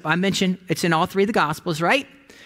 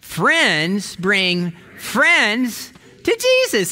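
very slow, louder, drawn-out refrain; slow call-along; 4 semitones higher, 4 dB louder, much slower than usual. Shown as a demonstration. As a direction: lead the crowd in saying it together. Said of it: "Can you say that with me?".

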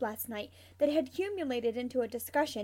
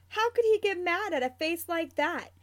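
put-on high, louder baby-talk adoration; cooing; 5 semitones higher, 6 dB louder than usual. A narrator's reading. James fusses over the lion cub, cooing. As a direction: coo over an adorable pet. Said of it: "James coos".

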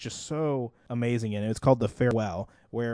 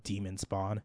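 low, much softer disgusted mutter; muttered aside; 4 semitones lower, 9 dB quieter, slower than usual. Retold or imitated. Muttering about a kid at work who screamed, low and soft. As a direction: grumble it under your breath. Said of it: "I'm like".

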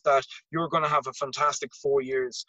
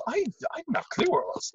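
high, fast dazed voice; 13 semitones higher, much faster than usual. Retold or imitated. Dazed self after concussion.

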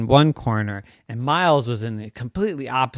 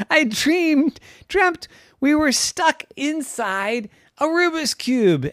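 put-on high, slow emphatic child voice; amazed kid recounting; 11 semitones higher, slower than usual. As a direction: tell a wild dream word by word. Jackson recounts his wild dream.

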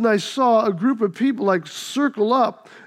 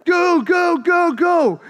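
chanting go go, pitched up high; cheering the fight on; 7 semitones higher, much slower than usual. Retold or imitated. Kids around the fight chanting, mock excited cheering. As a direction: egg on the fighters like a playground crowd.

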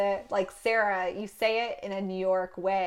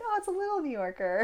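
cooing; 8 semitones higher than usual. Retold or imitated. Cooing over friend's son.

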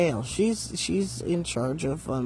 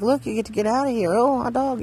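fast, gleeful, rising higher; all excited; 6 semitones higher, 4 dB louder, faster than usual. Retold or imitated. Speaker tells the grumpy roommate the land sale news, excited. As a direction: blurt it out quickly, thrilled at the news. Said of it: "and I'm all excited".

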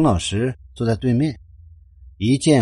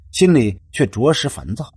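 usual speaking voice

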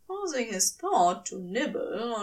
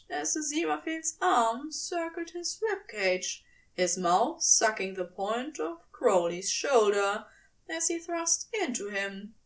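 ordinary delivery

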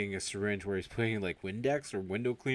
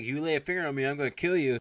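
raised, louder quarrel voice; mock angry threat; 6 semitones higher, 6 dB louder, faster than usual. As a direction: act out a heated warning.

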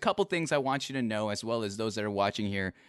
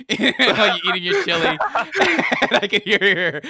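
loud, high, breaking into laughter; laughing teasing; 8 semitones higher, 10 dB louder than usual. Real, not acted. Host teasing guest about the mukbang, laughing hard. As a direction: tease through helpless laughter.

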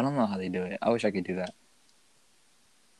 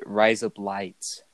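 slow demo; much slower than usual. Shown as a demonstration.

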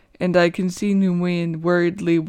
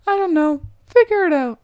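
teasing shrug; 9 semitones higher, 4 dB louder than usual. Acted. Nimuin shrugs off question.

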